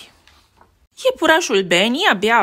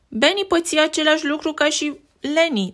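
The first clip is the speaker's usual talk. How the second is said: ordinary delivery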